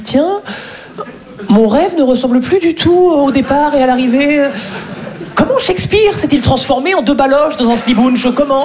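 high, exasperated wail of disbelief; mock inner outcry. Actor lamenting his ruined dream, high exasperated wail. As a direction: whine it out like a fed-up actor. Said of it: "et tu te dis".

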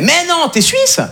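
high, incredulous "t'es suisse"; mock surprise. Calais fan learning he is Swiss, mock amazed. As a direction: play the amazed French guy. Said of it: "il me fait".

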